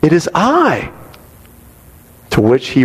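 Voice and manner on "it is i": lightly voiced woman, pitch lifting on key words; a hint of the mistress appealing to him; pleading mistress. Mistress runs after Augustine to ask what is wrong, lightly acted appeal. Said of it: "She says".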